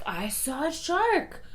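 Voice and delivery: slow, slightly high mock insistence; insistent firefighter. Imitating a man arguing with his friends, slow insistence. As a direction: lightly imitate a man standing his ground. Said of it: "he's like".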